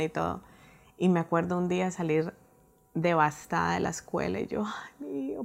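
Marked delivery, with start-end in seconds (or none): 4.7-5.4 breathy worried sigh for her son; worried whisper. Retold or imitated. Mother leaving school, whispering her worry for her son.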